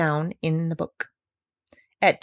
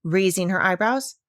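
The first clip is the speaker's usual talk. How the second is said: ordinary delivery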